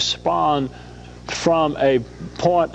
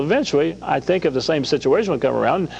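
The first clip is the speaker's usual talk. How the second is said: ordinary talk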